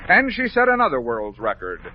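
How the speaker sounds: plain reading voice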